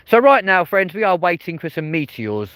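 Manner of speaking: sudden loud high burst; excited call. Real, not acted. Host calling out to viewers, suddenly excited.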